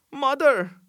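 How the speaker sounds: high, loud, agitated call; excited cry. A narrator's reading. Delirious boy calls out to his mother, excited cry. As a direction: cry out in delirium, pitch shooting up. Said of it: "his voice became excited".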